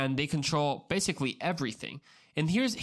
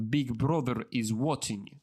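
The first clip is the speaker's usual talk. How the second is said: put-on Russian accent; 2 semitones lower than usual. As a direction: say it like a Russian propaganda slogan. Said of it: "why I think like a Russian accent".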